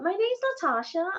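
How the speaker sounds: high American self-introduction; imitated American accent. Retelling an American girl introducing herself, high American accent. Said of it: "without an American accent".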